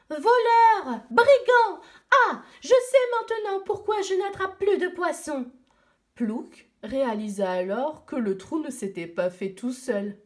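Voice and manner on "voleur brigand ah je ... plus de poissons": put-on high, loud furious cries of a little girl; angry shouting girl. Little Eskimo girl screams at the penguin thief, furious. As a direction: yell it out like a robbed child. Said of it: "arriva en hurlant".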